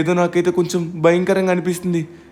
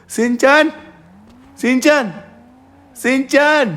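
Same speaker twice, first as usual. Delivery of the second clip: raised, higher-pitched call; 4 semitones higher, much slower than usual. Acted. Frightened friend searching for Shinchan, calling out. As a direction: call out the name to someone missing.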